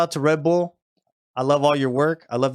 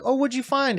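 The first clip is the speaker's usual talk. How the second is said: much higher, mock baby talk to the dog; cooing at a dog; 11 semitones higher than usual. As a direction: sweetly ask the pet what it brought. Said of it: "you'll just be like".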